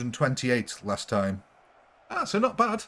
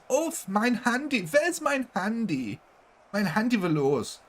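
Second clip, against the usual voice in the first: put-on much higher, sing-song camp German accent; 9 semitones higher than usual. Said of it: "the really camp German".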